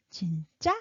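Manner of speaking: 진짜 starting low, then rising high; 진짜? asked low, then rising. Shown as a demonstration. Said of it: "So low tone and then high".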